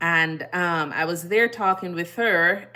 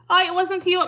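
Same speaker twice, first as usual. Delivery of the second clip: sudden high, louder surprise; surprised realization; 13 semitones higher, 6 dB louder than usual. Real, not acted. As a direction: caught off guard, voice jumps up. Realizes caller is someone else.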